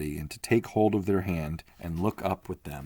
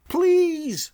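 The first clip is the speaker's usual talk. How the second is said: much higher and louder plea; shouting; 8 dB louder than usual. Acted. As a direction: cry out to be let in. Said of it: "shouted Leo Mathienne".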